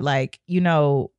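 ordinary delivery

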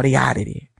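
usual speaking voice

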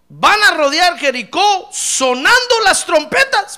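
loud, high, slow preaching call; booming proclamation. Acted. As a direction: proclaim the command to the whole crowd. Preacher proclaims God's command.

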